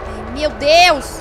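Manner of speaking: loud slow frightened cry; scared cry. Real, not acted. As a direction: drag out the panic, loud. Chased by monster, panics. (grito de medo arrastado)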